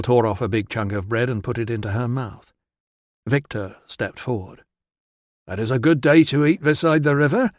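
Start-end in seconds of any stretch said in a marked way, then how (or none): 5.5-7.5 Victor's voice. Acted. Victor's careful first words.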